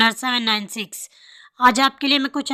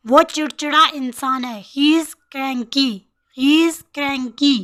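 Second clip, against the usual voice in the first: normal talking voice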